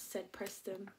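ordinary delivery, nothing marked

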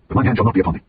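ordinary delivery, nothing marked

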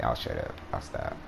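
ordinary talk, nothing marked

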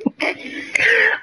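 breathy high-pitched laugh fit; high giggle. Real, not acted.

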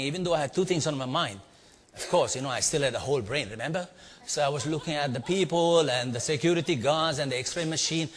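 normal talking voice

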